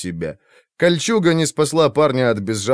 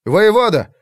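high, loud desperate call; shouting for help; 10 semitones higher, 5 dB louder than usual. A narrator's reading. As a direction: call out across the field. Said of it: "раздался чей-то крик".

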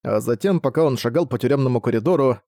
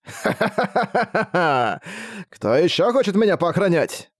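slow, higher mocking laugh; guffawing; 7 semitones higher, slower than usual. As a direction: laugh triumphantly at the guards. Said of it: "хохотнул он".

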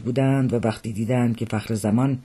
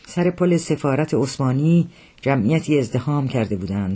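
usual speaking voice

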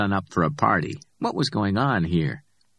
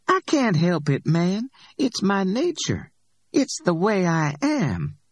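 higher-pitched Simone spinning the fable; Simone's voice, telling a fable; 10 semitones higher than usual. A narrator's reading. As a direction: tell it high and knowing, like a tale she loves. Simone tells scorpion fable.

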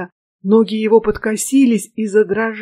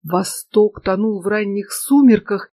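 ordinary delivery, nothing marked

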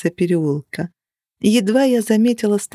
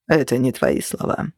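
sad; 5 semitones lower than usual. A narrator's reading. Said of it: "грустно покачал головой".

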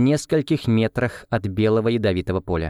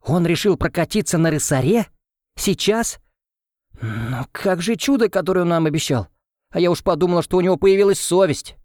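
higher, indignant guest's voice; a young lady's irritated, higher outburst; irritated exclamation; 7 semitones higher than usual. A narrator's reading. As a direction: scoff at him, annoyed and higher. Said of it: "раздражённый возглас нашей гостьи".

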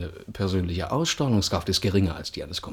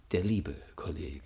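whisper; 3 semitones lower, 7 dB quieter than usual. Acted. Sarcastic aside about a colleague.

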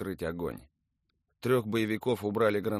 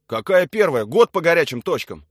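loud, raised, indignant; emphatic soldier's reply under fire; shouted retort; 8 semitones higher, 13 dB louder than usual. A narrator's reading. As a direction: shout back in protest.

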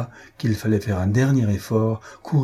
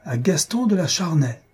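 higher, ringing proud name; a proud father toasting his son, sonorous; 7 semitones higher than usual. A narrator's reading. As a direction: sound the son's name with pride. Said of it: "d'une voix fière et sonore".

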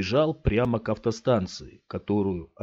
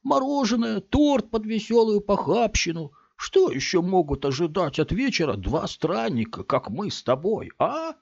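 higher, merry leering voice; jovial stranger; 11 semitones higher than usual. A narrator's reading. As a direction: sell the evening as fun. Big man jovial pitch.

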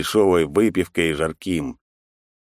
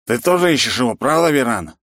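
put-on drunk insistent question; 6 semitones higher, 4 dB louder than usual. Drunk man presses hero.

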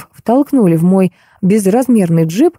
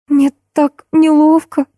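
put-on high, slow girlish lament; distressed girl; 9 semitones higher, slower than usual. A narrator's reading. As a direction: wail mildly with embarrassment, drawn out. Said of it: "заломила руки Маша". (смущённо причитает тонким голосом)

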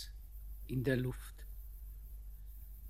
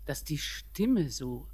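normal talking voice

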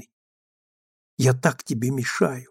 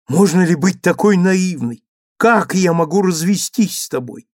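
higher, louder exasperated outburst; 6 semitones higher, 6 dB louder than usual. Acted. Husband exasperated at his naive wife, raised voice.

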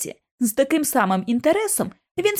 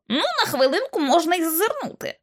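Kipps' voice; 8 semitones higher than usual. A narrator's reading.

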